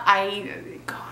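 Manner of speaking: breathy frustrated whisper under breath; exasperated whisper. Real, not acted. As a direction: mutter it to yourself in defeat. Fails to pronounce name.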